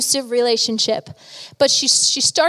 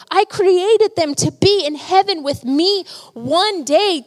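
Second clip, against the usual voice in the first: higher-pitched, emphatic preacher's delivery; impassioned preaching; 5 semitones higher, 4 dB louder than usual. Acted. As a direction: preach it hard, stretching the key words. Preaches at full force.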